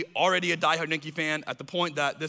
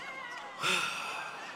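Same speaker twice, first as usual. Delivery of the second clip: weary sigh; 10 dB quieter than usual. Real, not acted. Exasperated sigh after Tim.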